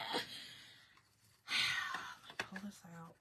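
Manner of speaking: breathy whisper to herself while working; whispered aside. Real, not acted. Crafter whispering to herself over the ribbon. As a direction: whisper it under your breath as you fiddle.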